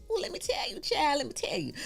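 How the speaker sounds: high, light sing-out to the audience; playful sassy aside. Pretend.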